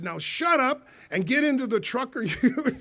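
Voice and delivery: high, firm telling-off, half laughing; stern order. Retold or imitated. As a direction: shock him into silence. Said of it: "I said".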